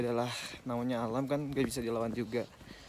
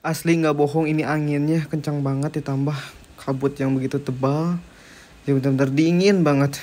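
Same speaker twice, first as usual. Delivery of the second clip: shouting over wind; 9 dB louder than usual. Real, not acted. Reporting from windy summit.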